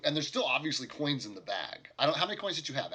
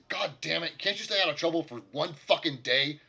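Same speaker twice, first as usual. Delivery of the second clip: angry; 5 semitones higher, 7 dB louder than usual. Acted. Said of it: "pretty angrily turns to Tim and said".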